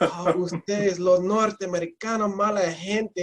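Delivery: mild imitation of an upset scolding; mock angry yelling. Upset Bogotá lady at a North American, mild mock yelling. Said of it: "she is just yelling".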